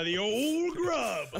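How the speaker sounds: drawn out slowly. Acted. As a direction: stretch out the old name. Savoring the silly name.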